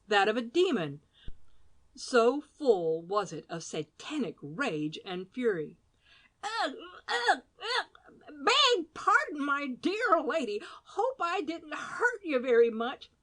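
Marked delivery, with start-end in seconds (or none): 6.4-13.1 high pained grunts then fussy apology; wince, then smooth it over; startled old gent. A narrator's reading. Badger knocked heads, apologizing.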